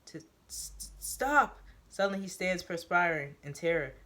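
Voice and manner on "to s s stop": breathless stammer. Acted. Strangled newbie forces out stop, breathless. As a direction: choke out the word stop. Gasping newbie struggles to speak.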